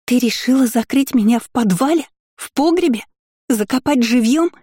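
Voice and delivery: high panicked shriek. A narrator's reading. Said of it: "Вновь завизжала гадина".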